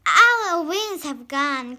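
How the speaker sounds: loud, joyful exclamation; excited cry. A narrator's reading. Father discovers he is human again, joyful cry. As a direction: shout it out in delight. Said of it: "cried Mister Gregg".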